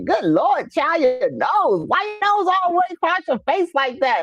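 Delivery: high mock country aunt, teasing. Imitating her country aunt mocking her big nose. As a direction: ham up the country aunt's blunt remark. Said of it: "you know, country".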